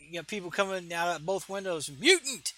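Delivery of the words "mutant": high, loud accusing yell; angry mob shout. Acted. Mob at windows shouting at girl.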